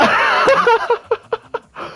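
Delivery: high-pitched disbelieving laugh; real shocked laugh; stunned laughter. Real, not acted. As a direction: burst into high, helpless laughter.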